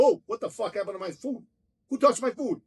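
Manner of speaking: high-pitched retold outrage; indignant demand. Inmate confronting cellmates over his empty tray, indignant demand. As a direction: act out indignant disbelief, pitch climbing. Acting out finding his food gone.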